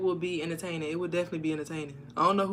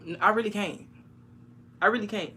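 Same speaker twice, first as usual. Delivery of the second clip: ordinary delivery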